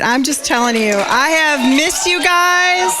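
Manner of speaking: normal talking voice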